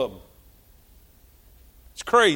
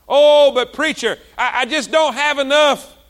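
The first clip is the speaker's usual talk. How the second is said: high, loud mock whine; whining excuse; 6 semitones higher, 5 dB louder than usual. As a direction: act out a lame excuse to the preacher. Mimics a congregant's excuse.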